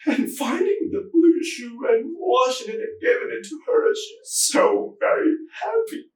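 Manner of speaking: high, tearful voice collapsing into crying; breaking into sobs. Acted. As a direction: start tearful and high, then sob through the words, slowing at the end. Breaks down imagining daughter happy.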